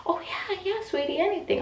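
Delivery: very high, cooing reassurance; sugary high-pitched yes; sweet nurse. Retold or imitated. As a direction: imitate an eager-to-help nurse. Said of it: "she was the sweetest nurse".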